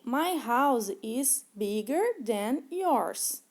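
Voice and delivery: very slow, deliberate English for learners; slow model sentence. Shown as a demonstration. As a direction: lay each word out like a teacher modeling. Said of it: "no exemplo". (frase em inglês bem lenta e didática)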